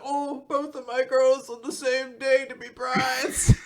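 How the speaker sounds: high, swooning mock voice; fainting father. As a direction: wail it as if about to faint, hammed-up. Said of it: "their father is, like, fainting".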